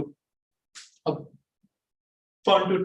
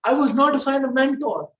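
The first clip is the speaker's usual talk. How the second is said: higher, lively, varied melody; expressive; 9 semitones higher than usual. Shown as a demonstration. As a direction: say it with feeling and emphasis. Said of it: "just say".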